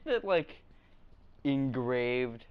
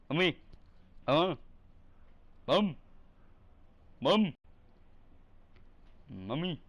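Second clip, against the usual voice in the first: put-on child calling; 2 semitones higher than usual. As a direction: kid calling for mommy.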